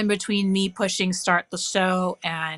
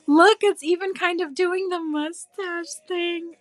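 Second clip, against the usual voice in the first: cooing; 11 semitones higher than usual. Real, not acted. Crafter coos at the gnome's mustache, high and slow. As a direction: coo over it like a puppy.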